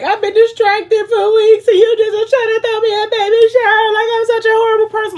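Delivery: loud, very high mock wailing; ugly crying. A narrator's reading. Wife crying over the baby shower surprise, hammed-up ugly cry. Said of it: "like, ugly crying".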